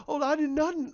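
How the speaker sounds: high, frightened "I did nothing"; mock terrified denial. Retelling the victim's plea, high mock fear. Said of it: "he was scared to death".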